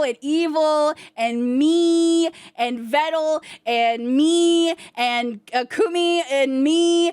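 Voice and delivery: slow, stretched-out list of names; cutesy roll call. Acted. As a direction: drag out each name adoringly. Cooing list of names in artwork.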